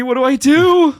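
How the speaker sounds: high, loud flustered squeal; mock panic. Storyteller with woman sitting next to him, mock-panicked squeal. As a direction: blurt it in hammed-up panic, pitch shooting up.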